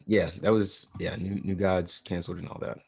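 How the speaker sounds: usual speaking voice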